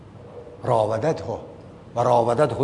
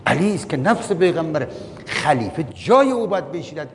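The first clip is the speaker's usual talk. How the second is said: usual speaking voice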